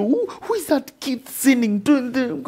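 lightly hammed-up divine scolding; God voiced. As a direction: playfully voice a watching God. God voiced watching sinner.

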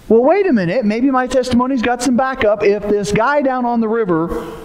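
ordinary delivery

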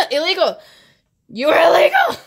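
loud, high mock accusation; playful outraged shout. Real, not acted. Fan to idol's photo, playful shouted accusation. Mock scolding of attractive idol.

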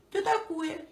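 very high squeaky voice. Retold or imitated. Owner mimics pet's squeak.

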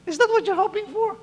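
very high, squeaky-voiced question; high-pitched challenge. Real, not acted. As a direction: repeat the challenge far up in pitch.

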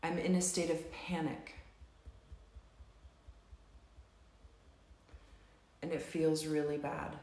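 slow, measured setup phrases with long pauses; tapping script read. Acted. Tapping leader reads the setup statements to the group, slow and measured. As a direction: guide the group through each tapping statement.

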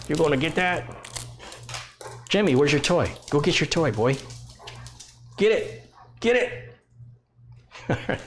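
high, excited fetch calls; urging the dog on. Real, not acted. Owner eggs on Jimmy to fetch his toy, high and excited. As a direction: egg the dog on to grab the toy.

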